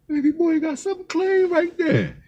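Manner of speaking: very high, soft squeal of delight; excited admiration. Real, not acted. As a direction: gush over it in a high, hushed voice. Admiring the young buck's ride.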